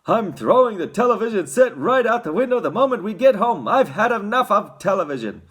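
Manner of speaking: loud, high fatherly rant; furious father laying down the law; angry shout. A narrator's reading. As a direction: shout it, thoroughly fed up. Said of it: "shouted Mister T V".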